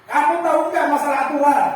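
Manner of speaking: loud, high, fast interrogation; punisher teacher scolding. Shown as a demonstration. Teacher interrogates a rule-breaking pupil, loud and stern. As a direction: grill the pupil sharply. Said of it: "nada suara tinggi".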